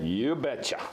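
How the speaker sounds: folksy Minnesota accent, played up a little. Shown as a demonstration. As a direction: sign off with a Minnesota "you betcha". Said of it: "in a Minnesota accent? Let's hear it".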